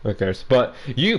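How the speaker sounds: usual speaking voice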